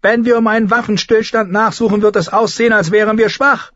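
very high, insistent character voice; forceful lady. Acted. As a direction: protest sharply against looking weak before the enemy. Lady Mormont objects forcefully.

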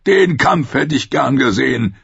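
boisterous Tormund, mocking and glad; booming wildling chief. A narrator's reading. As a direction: greet him like an old rough friend. Tormund voiced.

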